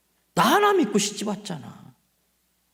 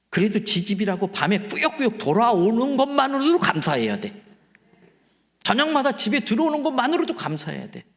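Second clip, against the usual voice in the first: usual speaking voice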